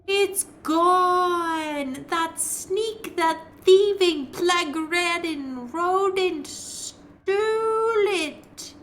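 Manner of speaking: high, slow, wailing ghost woman; wailing wraith. Acted. Wraith shrieking at the intruder, high wailing moan.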